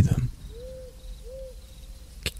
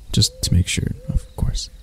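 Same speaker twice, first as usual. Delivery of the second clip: soft embarrassed whisper; sheepish, fading whisper; bashful whisper; 4 semitones lower, 8 dB quieter than usual. Acted. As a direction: hide the wish in a whisper. Embarrassed after the kiss.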